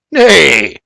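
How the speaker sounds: dismissive grumbled nay; grumbling. Acted. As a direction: wave it off with a grumble.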